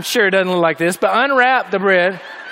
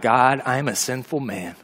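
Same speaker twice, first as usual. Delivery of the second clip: much softer, hushed confession to God; humble prayer; 8 semitones lower, 6 dB quieter than usual. Pretend. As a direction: quietly ask forgiveness, fading away. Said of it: "And we say to God".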